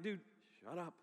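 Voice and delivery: low, soft grumble of annoyance; mock being fed up, under your breath; annoyed. Teen at camp silently answering the speaker, quietly annoyed. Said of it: "I was annoyed".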